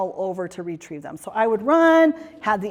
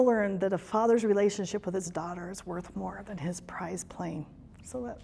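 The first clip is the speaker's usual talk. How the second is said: quiet, emotional, almost whispered; choked up; 3 semitones lower, 9 dB quieter than usual. Real, not acted. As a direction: hold back tears as you speak. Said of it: "I choke up".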